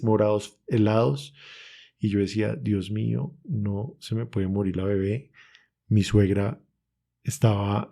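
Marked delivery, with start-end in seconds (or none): 2.6-5.3 desperate whispered prayer. Retold or imitated.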